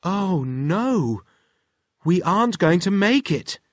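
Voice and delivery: put-on worried girl. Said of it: "said Wilma".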